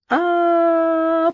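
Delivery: long rolling moan; high drawn-out groan. Acted. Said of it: "прокатился стон".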